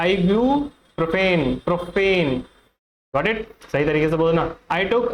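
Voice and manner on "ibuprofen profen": "Ibuprofen" slowly as eye-byoo, pro-fen; correct pronunciation. Shown as a demonstration. Teacher corrects the common version, slowly pronounced. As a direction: stretch "Ibuprofen", then repeat its "profen" ending. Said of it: "जबकि correct pronunciation क्या है".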